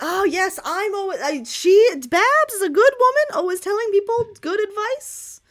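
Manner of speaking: high and loud, gushing seer; fortune teller voice. Acted.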